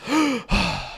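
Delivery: sharp high gasp for air; mock gasp. Streamer after a rapid list, high comic gasp. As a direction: gasp as if surfacing from a long read.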